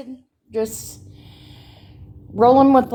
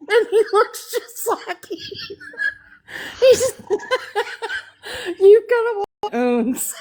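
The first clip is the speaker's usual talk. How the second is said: laughing; 8 semitones higher than usual. Real, not acted. Laughing at a funny video.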